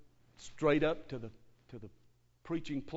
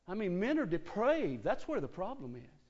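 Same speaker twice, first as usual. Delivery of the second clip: ordinary delivery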